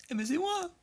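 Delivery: squeaky excited cry; surprised squeal. Real, not acted. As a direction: yelp in happy surprise. Surprised to see his own replay.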